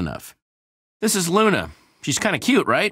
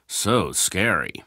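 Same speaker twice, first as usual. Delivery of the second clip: slow, dry mock fear; sarcastic; 6 semitones lower, much slower than usual. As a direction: drag it out, unimpressed. Mocking the admin's zombies.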